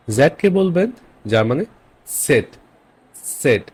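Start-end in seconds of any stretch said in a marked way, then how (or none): 2.1-3.6 "সেট" with a slight T before the S; German Z as TS. Shown as a demonstration. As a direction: open "সেট" on a German ts sound. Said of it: "সামনে একটা ত এর মতো আসবে". ("সেট"-এর সামনে হালকা ত)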